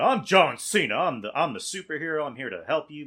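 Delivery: mock superhero introduction; John Cena. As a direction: announce yourself like a heroic good guy. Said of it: "works better in that role of like".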